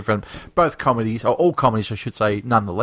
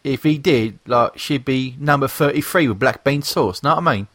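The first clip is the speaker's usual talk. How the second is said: hammed-up British geezer greeting; fake British accent. Podcast host introducing the lads, in a fake British accent. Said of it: "enough of the fake British accents".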